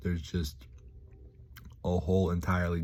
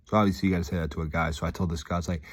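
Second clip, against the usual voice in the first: normal talking voice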